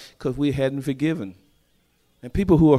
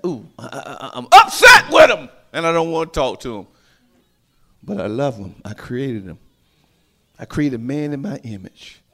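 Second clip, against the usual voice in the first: God shouting then softening; 5 dB louder than usual. Acted. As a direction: shout the anger, then settle into love. Voicing God, shout then soft.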